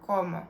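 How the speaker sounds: como with the stress on the first syllable "co-"; penultimate-syllable stress. Shown as a demonstration. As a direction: lean on the opening syllable of "como". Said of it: "falls on the penultimate syllable".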